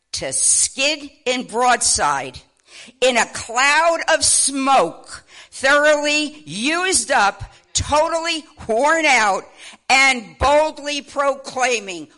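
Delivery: slow, rising to loud and high; building preaching. Real, not acted. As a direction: swell it into a proclaiming sermon voice. Preacher reads sign, building up.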